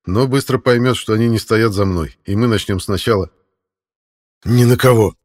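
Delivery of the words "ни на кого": forced out through pain; strained. A narrator's reading. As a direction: squeeze the words out, hurting. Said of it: "выдавил я".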